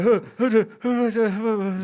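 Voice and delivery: high, slow mock panic gasps; mock hyperventilating as the train leaves; panicked panting. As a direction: pant like you've just woken up stranded. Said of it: "I was like".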